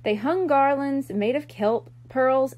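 ordinary delivery

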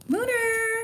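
high calling-out of the dog's name; sing-song call to a pet. Real, not acted. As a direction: call the dog from across the room. Calling the dog.

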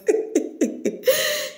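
high, drawn-out giggle; real amused laughter; laughing. Real, not acted. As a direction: burst into giggles at your own remark. Amused at her own remark.

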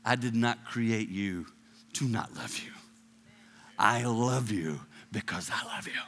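soft, low, tender reassurance; tender voice of God. Retold or imitated. God reassuring his child, soft and tender. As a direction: speak it gently like a loving father. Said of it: "looks right down at you and goes".

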